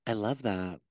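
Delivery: low, soft catchphrase impression; Lisa Barlow. Host echoes herself as a reality star, lightly mimicked. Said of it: "I'm so, like, Lisa Barlow sometimes".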